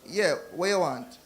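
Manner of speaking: slow Jamaican reply; a lightly put-on Jamaican accent. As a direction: answer slowly in a Jamaican accent. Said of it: "It's like".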